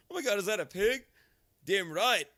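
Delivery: high, loud cartoon voices; Stewie voice. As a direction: act out the scene with glee. Retelling Stewie pig scene.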